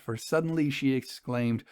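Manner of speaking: ordinary delivery, nothing marked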